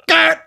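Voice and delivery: high, loud hammed-up yelp; mock shot cry. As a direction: yelp like a soldier hit by a bullet. Imitating a man getting shot.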